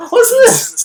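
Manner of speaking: very high, loud squeal through laughter; helpless laughing disbelief. Real, not acted. Viewer cracking up at silly running animation, laughing squeal. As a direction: blurt it out while really cracking up.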